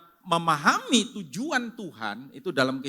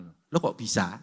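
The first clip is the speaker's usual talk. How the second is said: lower, drawn-out viral catchphrase; like a famous TikToker; 7 semitones lower, slower than usual. Retold or imitated. Pastor mimics a famous TikToker for the congregation, lower and drawn out. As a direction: mimic a TikToker's trademark line. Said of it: "Kayak TikToker terkenal".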